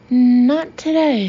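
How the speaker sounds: slow, drawn-out polite regret; genuinely regretful. A narrator's reading. Ben turning down the Russian, genuinely regretful. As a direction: decline slowly and loudly, with regret. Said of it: "genuinely regretful tones".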